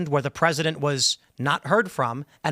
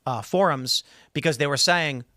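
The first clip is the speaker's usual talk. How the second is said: ordinary delivery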